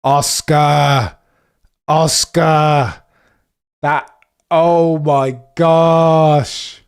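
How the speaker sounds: loud, drawn-out cries of the driver's name; calling out in disbelief, stretching the last words slowly; loud dismay. Real, not acted. Oscar just got overtaken.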